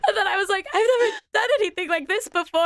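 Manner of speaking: flustered laughing. Retold or imitated. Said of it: "flustered squeaky retelling".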